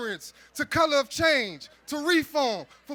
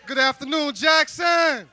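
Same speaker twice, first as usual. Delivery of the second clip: loud, slow rally call; rally speech; 7 dB louder, slower than usual. Real, not acted. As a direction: pump up the crowd, slow and loud. Hype man warming up rally crowd.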